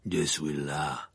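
low, slow grumbling voice; breathless growl. A narrator's reading. Moody arrives limping behind Dumbledore, breathless growl. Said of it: "grogna une voix essoufflée".

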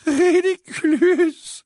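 very high, drawn-out wail of the spell; tearful repeated spell. A narrator's reading. Missus Wesley weeps harder, wailing the spell. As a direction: cry the incantation out, slow and loud. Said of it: "se mit à pleurer de plus belle".

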